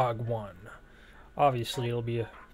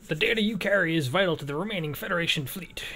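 higher, theatrical briefing voice; lilting, raised-pitch game narrator; put-on reading voice; 4 semitones higher than usual. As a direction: read it out like a hammy mission briefing. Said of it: "I don't know why I'm talking like this".

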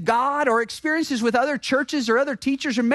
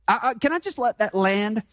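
usual speaking voice

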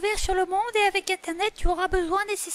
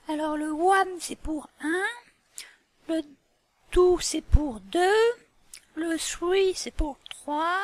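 slow teaching; slower than usual. Shown as a demonstration. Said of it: "teacher spells out numbers slowly".

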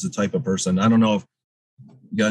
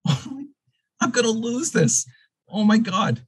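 high-pitched mock panic; acting out being terrified, voice shooting up; retold terror; 14 semitones higher than usual. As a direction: squeal it like a comic fit of dread. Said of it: "I was terrified".

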